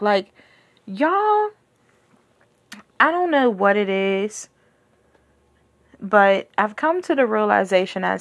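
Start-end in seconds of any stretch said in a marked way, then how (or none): none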